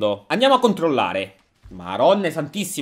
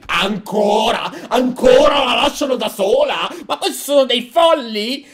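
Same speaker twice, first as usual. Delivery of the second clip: higher, drawn-out disbelief; incredulous outburst; 5 semitones higher than usual. Real, not acted. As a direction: react as if they have all gone mad. Incredulous reaction to the story.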